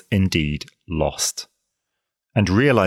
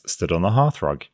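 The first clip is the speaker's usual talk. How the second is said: ordinary delivery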